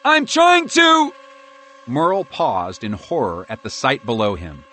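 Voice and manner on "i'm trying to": high, loud, slow struggling cry; cut-off cry of helpless effort; panicked shout. A narrator's reading. Merle panics as drone control fails.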